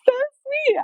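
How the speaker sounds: high-pitched, touched cooing; touched gushing. Retold or imitated. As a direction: retell being melted by someone's sweetness. Gushing reaction to kind driver.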